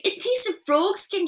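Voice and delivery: put-on high, slow gasping girl. A narrator's reading. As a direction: gasp it out disgusted. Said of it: "she gasped".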